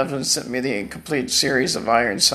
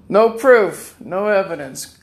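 forceful denial; 5 semitones higher, 5 dB louder than usual. Real, not acted. Denying allegations.

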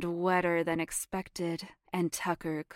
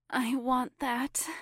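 pleading; 6 semitones higher than usual. Acted. Aroused character speaks.

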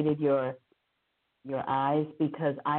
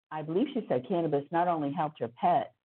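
ordinary talk

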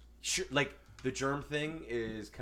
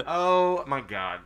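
laughing; 4 semitones higher, 7 dB louder, much slower than usual. Real, not acted. Reacting to teasing.

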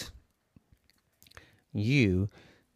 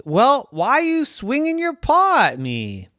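high, louder bee complaining; squeaky little bee, offended; 14 semitones higher, 6 dB louder than usual. Acted. Bee protesting the cat's swats, high and indignant. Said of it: "And the bee said".

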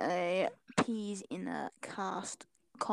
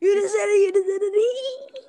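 high gleeful yell, caught you; triumphant shout; 11 semitones higher, 10 dB louder than usual. Real, not acted. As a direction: shout it out, gotcha. Catching a friend out.

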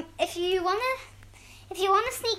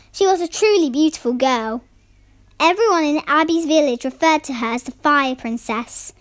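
louder storyteller voice; story narration; 7 dB louder than usual. Acted. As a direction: read it like a bedtime tale. Reads story aloud.

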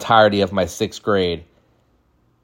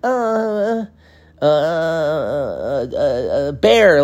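high, drawn-out hesitation, then a blurt; clueless child; 4 semitones higher, much slower than usual. Acted. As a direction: stall on long uhs, then burst out. Child struggling to recall own name.

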